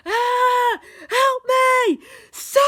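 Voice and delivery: very high, full-volume scream; screaming for help. A narrator's reading. Steve dragged away calls to Sonic, screaming. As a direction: shriek for rescue.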